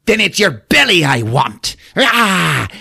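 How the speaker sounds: high menacing threat ending in a cry; snarling leprechaun villain. Acted. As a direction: threaten as a leprechaun, then yell and charge. Leprechaun lunges after his prey.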